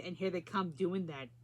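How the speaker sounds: usual speaking voice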